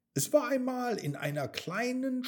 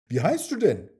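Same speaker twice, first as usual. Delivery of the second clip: put-on louder, eager child-like asking; eager question; 3 semitones higher, 7 dB louder, faster than usual. A narrator's reading. Mia asks the rabbit its name, eagerly. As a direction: ask a new friend, keen and loud.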